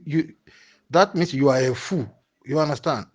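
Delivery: ordinary delivery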